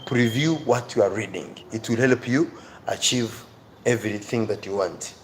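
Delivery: ordinary delivery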